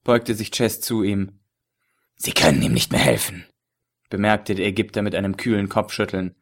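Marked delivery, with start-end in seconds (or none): 2.1-3.4 low, leering villain with a chuckle; lecherous villain voice. A narrator's reading. Ägypter, kühl. (tiefe, lüsterne Schurkenstimme)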